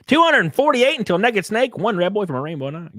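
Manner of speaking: high, loud comic readout; exaggerated goofy announcing; funny voice. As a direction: blurt the names in a silly high voice. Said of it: "I read them in a funny voice".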